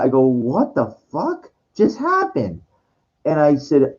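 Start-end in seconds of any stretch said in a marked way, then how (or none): 0.3-2.6 slow, high-pitched disbelief; retold bewildered reaction; dumbfounded. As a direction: draw it out, stunned. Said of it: "like, dumbfounded".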